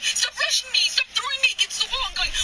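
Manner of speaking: high, loud, cracking with distress; begging her to stop, voice strained high and loud; desperate yell. Real, not acted. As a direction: shout like you're backed into a corner. Partner cornered in argument.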